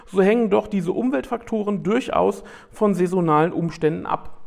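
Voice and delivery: steady read-aloud narration; nature documentary. Acted. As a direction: read it like a wildlife film voiceover. Reads prepared text aloud.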